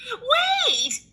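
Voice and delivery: granny startled; high shocked shout; alarmed grandma. Acted. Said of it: "she said".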